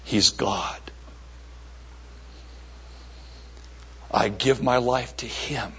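low, hushed, with long pauses; solemn confession. Acted.

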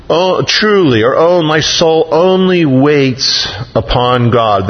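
high, slow, put-on holy voice; mock pious sigh. Pastor parodying a pious prayer while stuck in traffic, mock holy. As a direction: ham up an overly pious sigh.

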